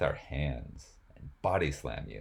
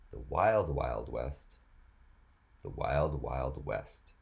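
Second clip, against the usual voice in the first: slow, flat-out recital of a chant; deadpan reading; slower than usual. Acted. Comedian reading rap lyrics slowly, deadpan recital. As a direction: read the hook like a dull text.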